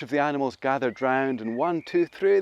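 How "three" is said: ordinary delivery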